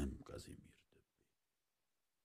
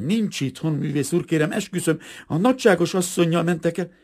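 high, loud, frantic pleading; frightened; 13 semitones higher, 11 dB louder than usual. A narrator's reading. Terrified maid assuring the painter, high and pleading. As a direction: swear it urgently in a raised, scared voice. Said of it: "A szobalány rémült szemmel".

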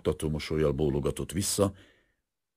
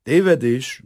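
firm, loud, serious correction; calm, serious; 9 semitones higher, 9 dB louder, slower than usual. A narrator's reading. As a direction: cut in firmly with sober conviction. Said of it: "mondta nyugodt, komoly hangon". (határozott, komoly hang)

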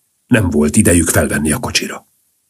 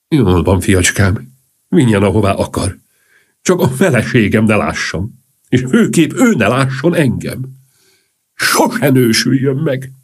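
lightly voiced count; 4 semitones higher than usual. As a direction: lightly play a count giving in, then advising. Count answers in story dialogue.

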